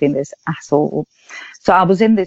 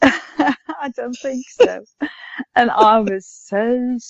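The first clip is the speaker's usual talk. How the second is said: laughing; 6 semitones higher, much slower than usual. Real, not acted. Delighted reply after big win.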